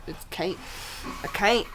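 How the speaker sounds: ordinary talk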